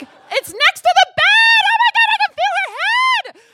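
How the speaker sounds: very high, loud re-enacted shriek; frantic scream. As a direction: scream it from mid-labour. Labouring, yells back at husband.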